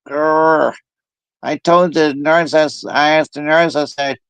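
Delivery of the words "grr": long "grr" of exasperation; mock frustrated growl. Kept awake all night, mock exasperated growl. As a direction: growl it out, half in play. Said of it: "I'm like".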